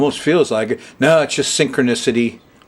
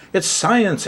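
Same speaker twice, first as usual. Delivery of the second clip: higher, emphatic it's science; insistent exclamation; 8 semitones higher than usual. Real, not acted. Astrologer defending astrology to his viewers, insistent and emphatic. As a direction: punch science with a high falling tune.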